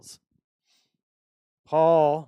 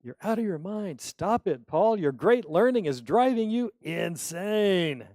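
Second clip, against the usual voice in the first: shouting; 6 semitones higher, 7 dB louder than usual. Retold or imitated. Festus shouting at Paul.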